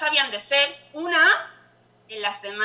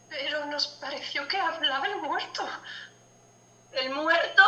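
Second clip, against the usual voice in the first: ordinary talk, nothing marked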